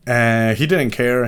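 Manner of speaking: ordinary talk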